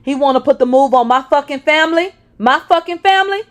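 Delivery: loud, high raging shout; exploding in rage. Acted. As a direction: scream it, losing control. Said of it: "Sean exploded even more".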